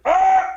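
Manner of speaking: sudden high loud scream of alarm; reactionary kid's scared yelp; panicked yell. Retold or imitated. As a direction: shriek in a burst of fear. Panicked yell grabbing brother.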